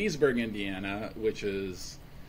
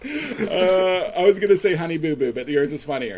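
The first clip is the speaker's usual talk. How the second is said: laughing high; 7 semitones higher, 11 dB louder than usual. Real, not acted. Laughing after the joke.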